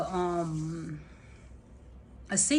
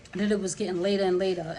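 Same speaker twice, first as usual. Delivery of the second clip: ordinary talk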